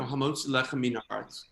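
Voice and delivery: put-on American-accented Hebrew, rattled off by rote; bad American accent. Saying the blessing before the meal, bad American accent. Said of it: "in my bad American accent".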